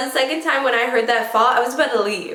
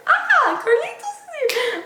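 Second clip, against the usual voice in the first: much higher, cooing hello with a laugh; high squealy greeting. Real, not acted. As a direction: squeal a delighted welcome. Greets arriving pet or child.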